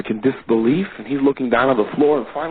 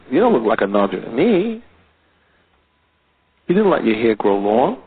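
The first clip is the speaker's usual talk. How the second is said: ordinary talk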